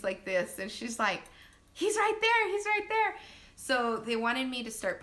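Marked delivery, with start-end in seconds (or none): 1.7-3.2 high, loud imitation of excitement; excited sister. Sister waiting to catch the baby, excited cry. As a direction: squeal it as the thrilled birth helper.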